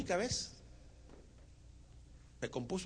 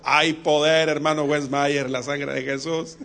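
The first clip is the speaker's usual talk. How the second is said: loud slogan; 2 semitones lower, 8 dB louder, slower than usual. Acted. Chanito repeats the slogan.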